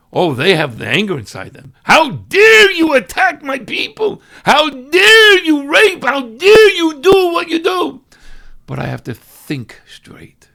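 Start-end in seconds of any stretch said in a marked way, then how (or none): 1.8-8.0 high, loud, slow, drawn-out furious shout; mock enraged leader shouting; furious outrage. Leader's rage at attack. 8.6-10.5 slow calm whisper; hushed self-control. Real, not acted. Whispered contrast after shouting.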